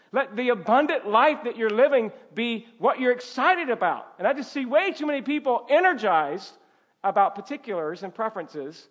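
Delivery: loud, high-pitched exhortation; urgent preaching. Real, not acted. Preacher urging church to start with Christ, urgent and loud. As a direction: drive the appeal home with force.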